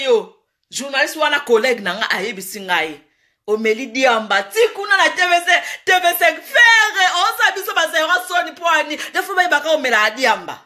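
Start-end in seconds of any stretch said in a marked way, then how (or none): 4.4-10.6 scolding. Real, not acted. Scolding the singer on his behaviour.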